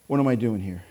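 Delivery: low, soft question to oneself; quiet self-questioning. Acted. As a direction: drop right down, as if catching yourself. David catching himself, asking inwardly.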